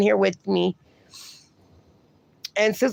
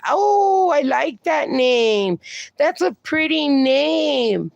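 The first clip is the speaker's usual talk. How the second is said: high, drawn-out cooing delight; delighted admiration; 4 semitones higher, slower than usual. Real, not acted. Host admiring a viewer's name Lacey, delighted. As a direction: gush slowly over something lovely.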